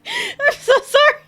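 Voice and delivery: high, loud, slow sorry through laughter; breathless giggly sorry after a fright; laughing apology. Real, not acted. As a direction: apologise while still cracking up.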